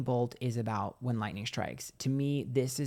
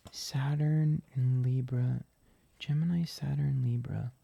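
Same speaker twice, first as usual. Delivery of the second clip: slow whisper to herself; slower than usual. Real, not acted. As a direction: read the names out in a slow whisper. Reading the cards to herself.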